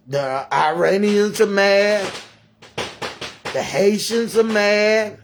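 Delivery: upset. Real, not acted. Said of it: "I'm upset".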